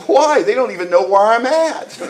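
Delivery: protesting newcomer. Retold or imitated. Newcomer protesting to the sponsor about court, mock-alarmed. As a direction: whine it like a worried newcomer. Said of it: "I said what every newcomer says".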